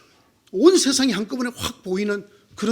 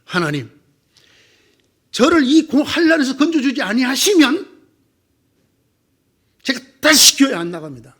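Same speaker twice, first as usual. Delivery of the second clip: louder, defiant ultimatum to God; threatening prayer; 3 semitones higher, 6 dB louder than usual. Retold or imitated. Believer threatening to quit church unless God saves him, loud defiant. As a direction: threaten God like a sulking bargainer. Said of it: "하나님을 협박하기도 한대요".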